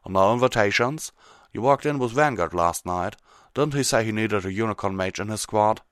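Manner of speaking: lightly voiced folksy prodding; prodding Applejack. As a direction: press with teasing questions. Applejack's character voice.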